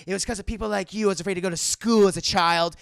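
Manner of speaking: loud, fast accusing rant; mock angry outburst. Mystique attacks the senator with her feet, loud acted fury. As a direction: hurl the accusation quickly and loudly.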